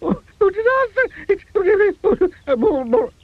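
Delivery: very high, rushing wails; frantic panic. A narrator's reading. Mister Jelly imagining crocodiles, frantic high wailing. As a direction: spiral into terror. Panics about snapping noise.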